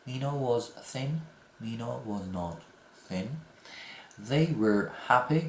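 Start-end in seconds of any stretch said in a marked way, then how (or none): 4.3-5.4 They were happy very slowly, rising; slow model sentence. Shown as a demonstration. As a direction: stretch it for learners. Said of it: "were not".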